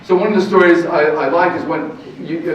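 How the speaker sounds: ordinary delivery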